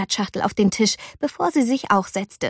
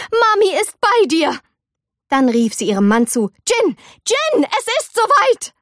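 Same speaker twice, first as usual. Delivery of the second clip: high, eager shouts down the hall; excited call; 10 semitones higher than usual. A narrator's reading. Mother calls her husband, high excited cry. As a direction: call out in a flurry.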